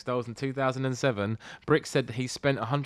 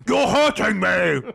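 high, loud, slow mock robot cry; hammed-up robot doll complaint; bad robot voice; 7 semitones higher, 9 dB louder, slower than usual. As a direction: blare it like a robot doll with the voice wrong. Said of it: "They haven't quite got the voice down yet".

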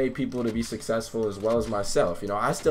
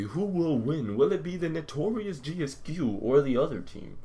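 mock anime cliffhanger narrator; lightly hammed-up show announcer; 5 dB quieter than usual. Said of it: "Next time on Dragon Ball Z".

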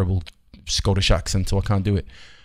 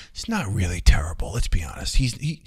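breathy whisper; whispered aside. Real, not acted. Confiding to viewers about the mourning, whispered. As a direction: let it slip as a confiding whisper. Undercuts people's mock grief.

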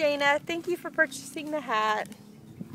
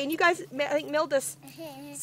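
ordinary talk, nothing marked

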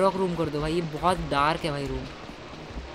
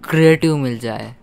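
loud, punchy YouTube intro voiceover; energetic, loud storytelling intro for gamers; video intro narration; 2 semitones higher, 9 dB louder than usual. Acted. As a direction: project it like you're hooking viewers.

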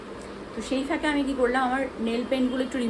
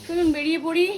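higher, louder upbeat call; cheery call out; 7 semitones higher, 5 dB louder than usual. Real, not acted. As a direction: sing it out like a send-off. Cheerful sign-off before leaving. (উঁচু, জোরালো খুশির ডাক)

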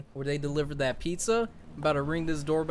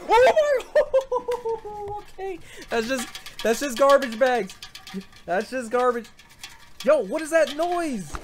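very high spooked squeal with a laugh; jumpy at every shape in the dark; nervous laughing fright; 11 semitones higher, 3 dB louder than usual. Real, not acted. Player scared by garbage bags, high nervous laughter.

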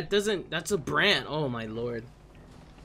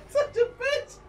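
1 high laugh-crying. Real, not acted. Panicked laugh-crying after fleeing.

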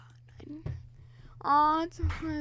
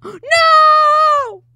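gasp and shout; 17 dB louder than usual. Real, not acted. Character dies suddenly.

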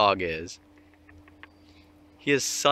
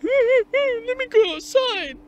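high, slow, whiny pleading as the dog; squeaky, stretched-out dog whine; whining dog voice; 4 dB louder, slower than usual. Retold or imitated. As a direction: beg to go outside like a whimpering dog. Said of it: "He's always fucking whining".

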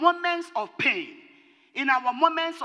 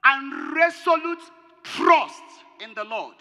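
loud, slow, emphatic pulpit point; hammered sermon emphasis; forceful preaching; 2 semitones higher, 4 dB louder than usual. Acted. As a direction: drive each word into the congregation. Preacher hammers his second point.

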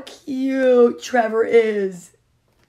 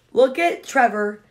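ordinary delivery

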